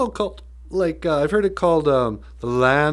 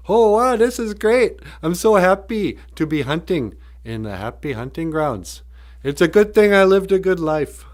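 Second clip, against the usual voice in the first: loud, raised-pitch mock hunter's glee; excited hunter; 4 semitones higher, 5 dB louder than usual. Hunter marvelling at the happy hunting grounds, mock excited. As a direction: gush like a thrilled hunter, hammed up.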